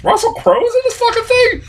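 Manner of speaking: very high-pitched retold disbelief; incredulous reaction. Reviewer recalling seeing an actor in previews, high and incredulous. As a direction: squeal the question in mock astonishment.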